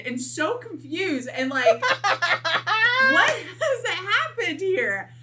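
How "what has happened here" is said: slow, higher, cracking up; laughing disbelief. Retold or imitated. Two naked people asking what happened, laughing. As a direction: drag it out while laughing.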